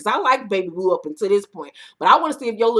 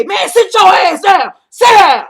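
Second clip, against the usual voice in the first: much higher, much louder, slow angry shout; retold shout; 9 semitones higher, 12 dB louder than usual. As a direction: yell at him to sit down. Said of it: "Baby Blue say".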